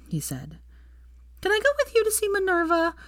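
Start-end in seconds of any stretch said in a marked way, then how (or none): 1.4-3.0 put-on very high little-girl begging; high-pitched childish pleading. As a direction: beg like an eager child, drawing out the please. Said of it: "Rose pleaded".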